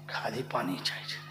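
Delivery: quick unvoiced whisper to a helper; whispered aside. Real, not acted. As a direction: drop to a whisper off-mic. Aside whisper during sermon. (মঞ্চে সহকারীকে ফিসফিস করে বলা)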